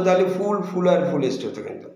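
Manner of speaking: usual speaking voice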